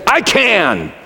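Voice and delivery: high, puffed-up mock boast; arrogant ego boast. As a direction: imitate a cocky ego on a high pitch. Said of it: "our ego that arrogantly says".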